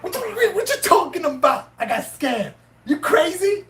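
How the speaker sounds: shocked disbelief, voice jumping high with a laugh; can't believe what he's hearing; high, laughing outrage at being told he got scammed; incredulous. Retold or imitated. Retelling his shocked reply to scam.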